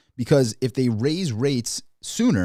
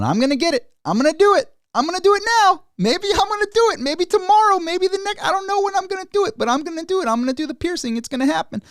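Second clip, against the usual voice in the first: much higher, louder taunting singsong; mock teasing piercer; 4 dB louder than usual. Piercer to a crying kid, endlessly stalling on the piercing, shrill mocking singsong. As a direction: drag it out like a tormentor who never does it. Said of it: "with a kid crying in the seat going".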